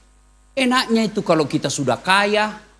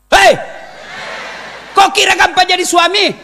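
loud; 10 semitones higher, 4 dB louder than usual. Real, not acted. Preacher repeats the line, shouting.

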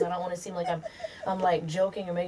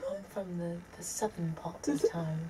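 pretend English accent; 8 dB quieter than usual. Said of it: "so I can fit in".

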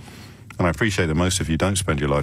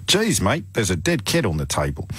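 mock Australian, pitched up on "Geez, mate"; Australian accent. Radio host repeats the line for doubting texters, put-on Australian accent. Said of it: "it wasn't an Australian accent".

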